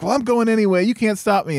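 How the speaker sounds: a lightly voiced defiant youngster; defiant kid voice. Defiant son retorts to dad.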